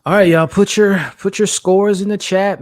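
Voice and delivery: rally the live chat to vote; calling out to chat. Real, not acted. Host calling out to his live chat, loud and hyped.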